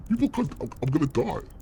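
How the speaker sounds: panicked high voice. Real, not acted. Scared during horror game.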